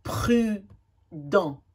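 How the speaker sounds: each word stretched very slowly, syllable by syllable; slow syllable reading. Shown as a demonstration. Teacher reading practice words slowly for children. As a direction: read the word as if decoding it slowly. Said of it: "slow decoding of word".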